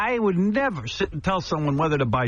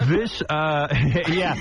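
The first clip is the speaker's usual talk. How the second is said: ordinary delivery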